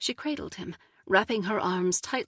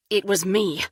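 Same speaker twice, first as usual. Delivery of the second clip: louder, sudden confession; blurted out; 8 dB louder than usual. A narrator's reading. Jealous lover confessing to the couple, blurting. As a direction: blurt it before you can stop. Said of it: "Kyle blurted".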